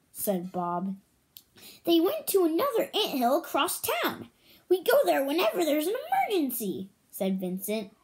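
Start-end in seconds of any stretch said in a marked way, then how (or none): 1.8-6.9 higher, hurried small ant voice; tiny ant voice. A narrator's reading. Vincent the ant speaks.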